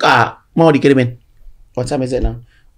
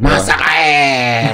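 "Masak air" drawn out very slowly; slow punchline; much slower than usual. Shown as a demonstration. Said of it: "penutupnya kurang lucu". ("Masak air" diucapkan sangat pelan dan panjang)

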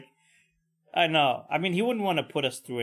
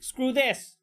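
put-on high, upset child voice; upset kid; 9 semitones higher than usual. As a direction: huff it out like a fed-up kid. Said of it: "I was like".

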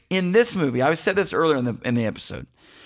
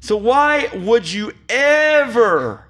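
loud, high comic rant, shouting; 6 semitones higher, 4 dB louder, slower than usual. Acted. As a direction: rail at Michael Bay for laughs. Mock rant at Michael Bay.

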